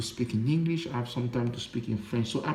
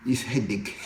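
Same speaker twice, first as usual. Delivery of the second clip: laughing. Real, not acted. Laughs about languages being hard.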